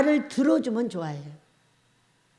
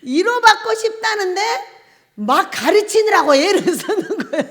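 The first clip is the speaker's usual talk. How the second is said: usual speaking voice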